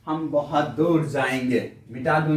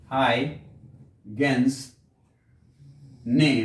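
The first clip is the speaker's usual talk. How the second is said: "Huygens ने" very slow, split by long pauses; slow dictation; 4 semitones lower, much slower than usual. Real, not acted. Teacher dictating "Huygens ने" for students to write, slow and broken up. Same words dictated slowly for writing.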